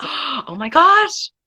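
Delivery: slow, breathy gasp of delight; gasping surprise. Real, not acted. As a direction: melt at the sight of the puppy. Dog appears on camera.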